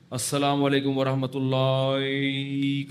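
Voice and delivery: slow, a touch warped salaam; mock twisted salaam. Preacher mocking the imam's turn-of-the-head salaam, slow. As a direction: lightly mimic the imam twisting the greeting. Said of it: "کر کے ادھر گھما دیا".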